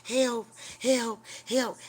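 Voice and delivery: lightly voiced desperate souls calling help; pleading voices. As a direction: imitate the damned begging for help. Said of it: "people reaching for me like".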